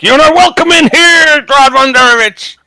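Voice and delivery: high, loud mock proclamation; acted banishing shout. Acting out an earlier banishing.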